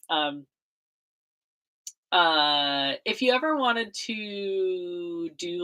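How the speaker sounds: usual speaking voice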